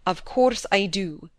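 slow, weighted, stern reply; stern husband's terse answer; short and stern. A narrator's reading. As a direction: answer curtly, shutting down discussion. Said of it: "short and stern".

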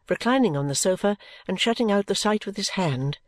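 ordinary delivery

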